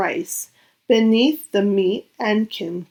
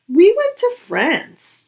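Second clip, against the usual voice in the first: much higher, bright classmate boast; chirpy child voice; 10 semitones higher, 4 dB louder than usual. A narrator's reading. Classmate boasting about her trip to France, high child voice. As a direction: pitch it up like a proud kid.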